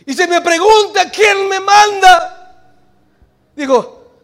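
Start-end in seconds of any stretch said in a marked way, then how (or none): 0.1-2.8 higher, slow, louder pleading question; Moses asking. Acted. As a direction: ask it like anxious Moses. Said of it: "al Padre y dice".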